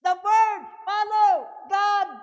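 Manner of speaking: loud, slow emphatic preaching; preaching shout. Real, not acted. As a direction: drive each word home to the congregation.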